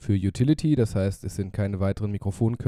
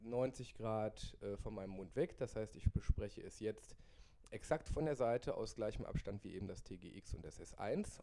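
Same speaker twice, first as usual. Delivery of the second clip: spoken into the side of the mic, much softer; off-axis mic; 15 dB quieter than usual. Real, not acted. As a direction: talk past the mic from the side. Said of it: "Ich drehe das Mikrofon jetzt neunzig Grad".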